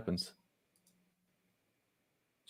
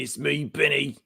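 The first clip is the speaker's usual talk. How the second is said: mock Australian accent; 3 semitones higher, 3 dB quieter, slower than usual. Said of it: "That was like, that's, like, British".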